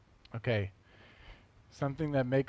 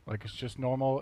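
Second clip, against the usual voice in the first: ordinary talk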